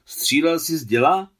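high, loud, rising question; eager shouted question. A narrator's reading. Boy asks Adam whether he fired cannons, eager shouted question. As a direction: call it out, burning to know.

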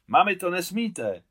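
cry out. A narrator's reading. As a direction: stop his mother in alarm. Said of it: "vykřikl Alexej".

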